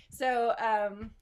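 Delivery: ordinary talk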